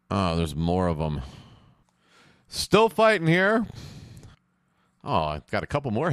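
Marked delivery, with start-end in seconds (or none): 2.5-3.7 strained high. Real, not acted. Mid-fight commentary.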